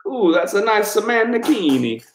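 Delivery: high put-on admiring coo; mock voice. Reading a chat name aloud, high mock coo.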